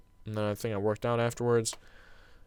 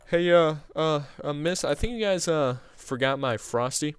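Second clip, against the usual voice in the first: put-on kid's voice; 6 semitones higher, 4 dB louder than usual. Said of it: "he was like".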